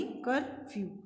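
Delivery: "Curfew" slowly in two parts, कर, then few; split into syllables. Shown as a demonstration. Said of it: "हमने इसको टू part में बोला".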